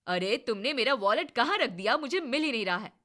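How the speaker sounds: loud demanding yell; husband shouting at wife for his wallet; angry shout. Acted.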